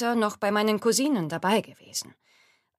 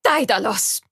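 loud call; 2 semitones higher, 7 dB louder than usual. Acted. Laboring woman calls to Daidalos.

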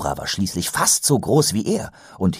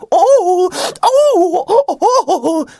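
much higher, louder wailing oh oh; nasal whine; 7 dB louder, much slower than usual. Acted. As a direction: howl in pain like a hurt goblin. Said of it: "jammerte er".

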